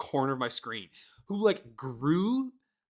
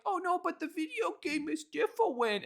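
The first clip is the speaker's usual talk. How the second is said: high, mocking imitation of defenders; mocking whiny voice; 14 semitones higher, 6 dB quieter than usual. Imitating game defenders to friends, high mocking voice. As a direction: parody their excuse in a silly high voice.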